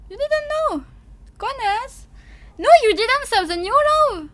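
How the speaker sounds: high, loud, incredulous outcry; mock shock. Streamer teasing viewers about an invented new law, mock shocked. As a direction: feign loud, high-pitched disbelief.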